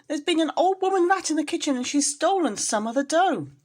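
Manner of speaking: very high, loud breathless telling; a scared kitten reporting the rat; high frightened squeak. A narrator's reading. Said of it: "in a terrible fright".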